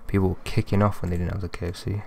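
ordinary delivery

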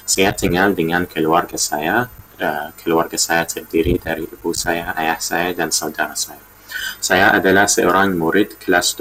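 Indonesian with a non-native accent; careful Indonesian from a foreign learner; foreigner speaking Indonesian. Real, not acted. Learner introducing himself in Indonesian.

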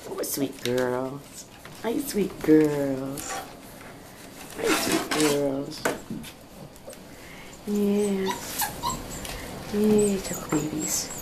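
slow, drawn-out cooing to puppies. Real, not acted.

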